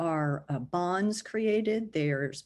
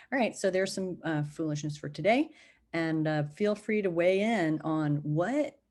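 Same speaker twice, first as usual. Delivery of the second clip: ordinary talk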